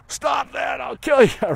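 high, loud pretend yell at the dog; mock angry yell. Owner losing it at a barking dog, mock yell. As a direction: yell like a fed-up owner, hammed-up. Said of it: "We go".

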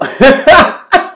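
high burst of laughter; loud laugh. Real, not acted. Laughs at own point.

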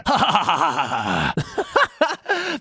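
low evil cackle; villainous cackle; evil laugh. Acted. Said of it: "That's my evil laugh".

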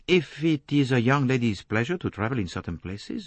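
put-on French-accented gentleman, calmly reasoning; courteous, lilting Frenchman; measured French detective. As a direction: lay it out politely with a French accent. Voices Poirot reasoning with her.

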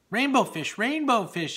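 loud, high call across the water. Acted. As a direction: call out to a friend far ahead. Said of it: "he called".

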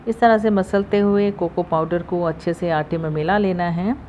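usual speaking voice

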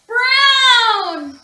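plain reading voice

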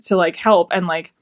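normal talking voice

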